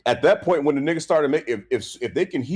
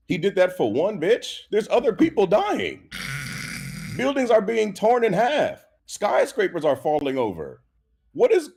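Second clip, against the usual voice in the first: building outrage; 7 semitones higher than usual. Retold or imitated. Ranting about collateral damage.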